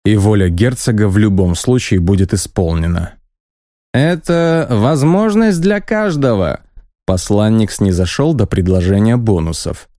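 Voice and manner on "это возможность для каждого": high, slow, squeaky pitch; envoy offering rewards haughtily. Acted. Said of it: "высоким, громким и совсем немного писклявым голосом".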